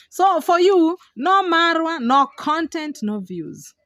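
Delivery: loud and high, building a chant; mock slogan. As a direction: hammer each "no" like a rally chant, then drop on "no views".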